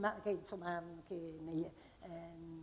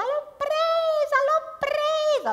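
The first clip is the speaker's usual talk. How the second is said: put-on much higher, slow, louder girlish squeal; 6 dB louder, much slower than usual. Teresa squealing in triumph over the butterfly. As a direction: shriek it like a thrilled little girl. Teresa shrieking in parody poem.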